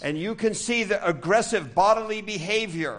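high, loud, heated. Shown as a demonstration. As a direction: describe the aggression at full volume. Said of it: "starting to raise their voice". (mit lauter, erregter Stimme)

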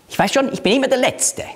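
high-pitched, quick acted pout; mock sulking. Sulker complains to family about always being last, mock sulking. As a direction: play the sulker, voice pushed well up. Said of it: "Das sind Schmoller".

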